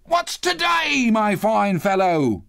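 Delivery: high, drawn-out hearty call. A narrator's reading. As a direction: hail the boy slowly and eagerly. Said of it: "calling downward to a boy".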